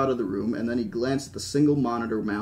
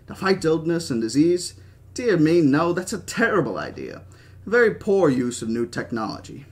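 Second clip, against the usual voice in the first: put-on animated old British speechmaker; Hammond; 3 semitones higher than usual. Old founder lecturing Henry on business, animated British voice. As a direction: lecture and scoff with a British accent.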